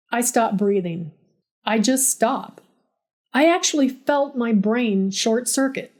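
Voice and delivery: plain reading voice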